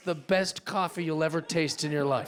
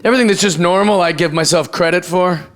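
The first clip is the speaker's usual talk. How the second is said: louder, pushed stand-up punch; loud delivery; 11 dB louder than usual. Real, not acted. Comic tells the crowd about sobriety, much louder. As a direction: belt the bit to the back row.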